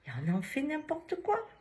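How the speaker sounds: high-pitched indignant disbelief; retold exasperation. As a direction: throw it up high, as a quoted protest. Retelling patient's exasperation.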